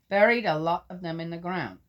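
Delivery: solemn public reading of Job; scripture reading. Acted. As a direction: proclaim it like a lector. Reads scripture.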